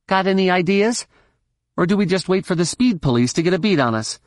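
high-pitched, fast needling; sarcastic young woman pilot. Acted. Pilot needles her companion as they are followed, impatiently.

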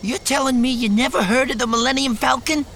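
put-on high, loud nervous young man. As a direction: squeak it out like a startled kid. Post voiced.

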